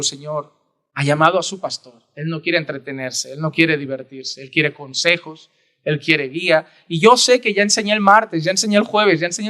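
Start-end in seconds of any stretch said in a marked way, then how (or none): 0.0-6.7 hushed prayer. Acted. Pastor praying before visiting a church member, hushed prayer. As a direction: murmur it to God in earnest.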